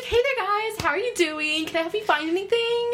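chirpy shop assistant voice; customer service voice. Retold or imitated.

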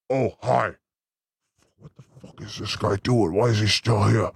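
low, muttered impression of an irritated guest; Bob. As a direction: drop deep and grumble under a polite hello. Imitates guest Bob, annoyed.

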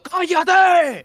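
grito agudo y fuerte de hacer callar; grito de cállate. Retold or imitated. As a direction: grita para que se calle el padre. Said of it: "cuando estoy diciendo". (grito agudo y fuerte a su padre)

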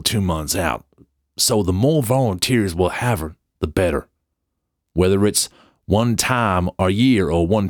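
hammed-up American accent, emphatic and deliberate. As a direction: do an amateur American accent, leaning on each phrase. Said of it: "I'm gonna do an American accent".

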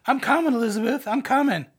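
high, melodramatic sitcom death-scene cry; Sanford and Son. Retold or imitated. Preacher likening Jonah to a sitcom character, hammed-up cry. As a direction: ham it up as a mock dying call, pitched high. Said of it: "Sanford and Son, right?".

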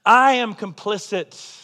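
solemn self-indictment; slow emphatic confession. Real, not acted. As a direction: lean hard on each word of I am complicit. Pulpit confession, repeated refrain.

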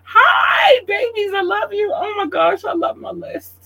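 high squealing joy, voice cracking up; excited squeal. Real, not acted. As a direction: squeal with delight at her list. Canada on the shout-out list.

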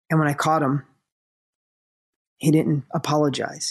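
subdued, halting confession with long pauses; serious confession. Retold or imitated. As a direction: retell it gravely, pausing between phrases. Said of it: "she got really serious".

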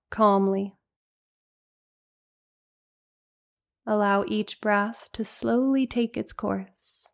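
unhurried meditation guide with long pauses; spacious, calming breath-by-breath guidance; guided meditation. Real, not acted. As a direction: lead them gently into rest, phrase by phrase.

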